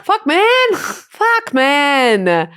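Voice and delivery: high mock Canadian "Fuck, man"; Canadian accent. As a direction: ham up a Canadian drawl. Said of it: "Like, a Canadian accent".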